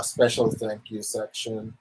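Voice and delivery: ordinary talk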